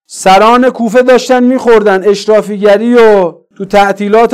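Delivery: loud, high, impassioned sermon; full-force preaching. Real, not acted. Preacher denouncing feasting leaders of Kufa, full-force preaching. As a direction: denounce them at the top of a preaching voice.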